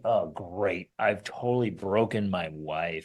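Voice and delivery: a hint of despair, voiced low; mock doom. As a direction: play the panicked husband, low and glum. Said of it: "as a man thinking".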